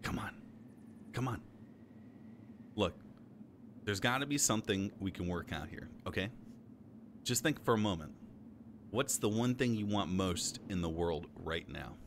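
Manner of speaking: deep, soft persuading; low coaxing voice. Acted. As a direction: coax in a low voice.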